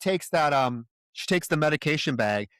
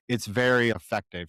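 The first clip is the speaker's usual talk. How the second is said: ordinary delivery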